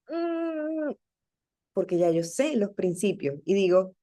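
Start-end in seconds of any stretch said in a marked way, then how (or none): 0.1-0.9 high, soft "mmm" squeal; imitated sound of being squeezed, held high; pained wince noise. Speaker showing how a bad answer feels, pained hum. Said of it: "como si me hicieran así".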